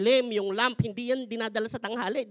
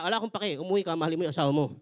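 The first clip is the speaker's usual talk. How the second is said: God speaking; 8 semitones lower, faster than usual. Acted. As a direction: answer like a patient Father. God voice continues.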